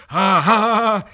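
loud, high crazed laugh; mad laughter. Acted. Pilot's boy laughing madly at the rowing mariner. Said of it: "laughed loud and long".

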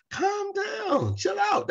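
very high, mocking calm down; hammed-up falsetto calm down. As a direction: squeak it like an annoying friend soothing. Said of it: "somebody says".

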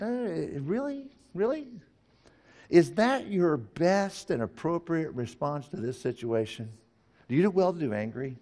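lightly voiced gentle God, high questioning; God asking gently. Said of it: "The Lord said".

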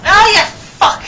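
high, loud outburst; loud frustrated yell. Real, not acted. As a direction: blurt it out in frustration. Reacts to game death.

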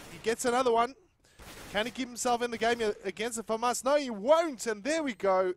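loud, high, building to a shout; hyped play-by-play. Real, not acted. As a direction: call the clutch at full hype. Clutch round live play-by-play.

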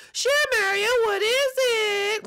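very high, drawn-out falsetto; Peach. Acted. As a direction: answer in a squeaky sing-out princess voice. Peach answers Mario in skit.

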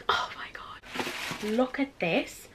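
breathless slow whisper; awed whisper. Real, not acted. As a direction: hush in awe at it. Awed reveal of shoe.

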